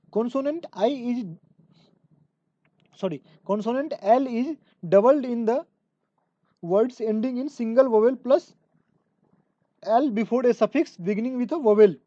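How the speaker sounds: slow, deliberate rule dictation; reading a rule aloud. Acted. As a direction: read the rule slowly so students can follow. (धीमे-धीमे नियम बोलकर लिखवाना)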